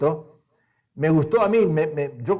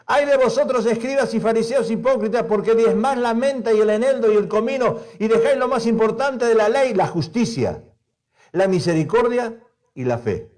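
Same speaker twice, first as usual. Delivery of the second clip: raised, forceful Bible reading; preaching scripture; 6 semitones higher than usual. Acted. Preacher reading Jesus' woes to the church, forceful. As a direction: proclaim each woe like a warning.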